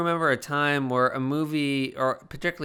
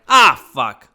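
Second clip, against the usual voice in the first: loud, delighted ah fuck; loud happy curse; 14 dB louder than usual. Retold or imitated. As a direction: shout it like a revelation. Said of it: "But this time I was like".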